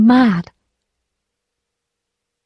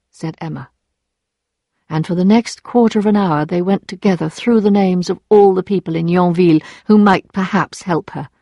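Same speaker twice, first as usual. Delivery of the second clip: ordinary delivery, nothing marked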